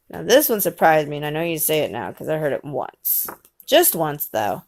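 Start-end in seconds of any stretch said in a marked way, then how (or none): none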